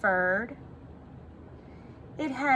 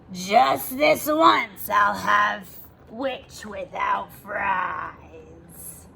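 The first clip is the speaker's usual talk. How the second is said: slow, looming dragon growl dropping lower; menacing dragon; 3 semitones lower, much slower than usual. Acted. Dragon drawing near the witch, slow and menacing. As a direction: savour each word like a predator.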